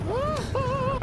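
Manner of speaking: high "woohoo"; gleeful whoop. Real, not acted. Player pleased with the game, gleeful whoop. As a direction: whoop high in two notes. Getting past danger in game.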